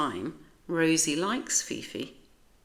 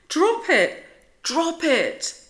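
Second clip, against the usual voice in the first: high, loud urgent command; urgent shout; 12 semitones higher, 7 dB louder, slower than usual. A narrator's reading. Rosie shouts at Maurice to drop Fifi, urgent and loud. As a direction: yell it to save her friend.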